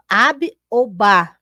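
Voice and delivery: ab ou ba said slowly, each syllable apart; teacher sounding out ab and ba; syllables spelled out. Shown as a demonstration. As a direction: sound out ab, then ba, clearly. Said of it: "podem ser montadas com B e com A".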